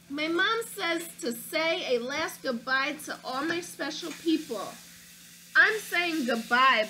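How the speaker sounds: put-on lively read-aloud for children; story narration. A narrator's reading. Reading a picture book to children, lively storybook narration. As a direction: tell it like a picture book to kids.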